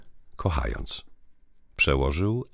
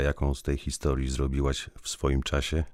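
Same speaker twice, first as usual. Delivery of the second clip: ordinary delivery, nothing marked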